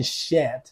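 "shit" said as "shet"; mispronounced "shit", rhyming with "set"; wrong vowel. Shown as a demonstration. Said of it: "这不念shit".

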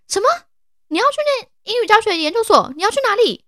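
high, fast astonished repeat-back; repeated exclamation. Retold or imitated. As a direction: echo her words in total disbelief. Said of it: "连续惊呼".

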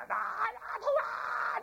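very high, drawn-out wail; mock pain cries. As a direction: wail like a big man in agony. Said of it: "就叫那个". (模仿痛得高声惨叫)

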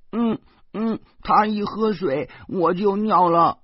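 put-on child voice. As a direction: nod along like a small boy.

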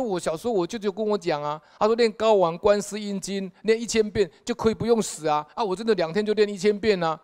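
high, urgent pleading; eager pleading. Retold or imitated. Dead man pleads his case.

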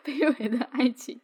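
slow, hammed-up woeful sigh; a mock-pitiful lament about humble love; mock tragic lament. Friend mocking a lovesick couple, slow mock-tragic sigh. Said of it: "好卑微哦".

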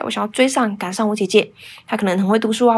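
ordinary talk

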